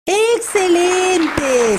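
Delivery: stretched praise. Real, not acted. Praising the children's answer.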